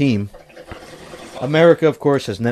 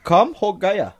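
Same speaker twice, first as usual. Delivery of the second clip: put-on lightly done Indian accent; a touch of Indian accent; 5 semitones higher, slower than usual. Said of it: "say it in an Indian accent".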